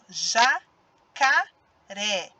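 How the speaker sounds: "Jacaré" very slow, broken into ja, ca, ré; syllable by syllable. Shown as a demonstration. Teacher repeating the word for pupils, syllable by syllable. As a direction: space out each syllable of "Jacaré". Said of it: "Ó, de novo".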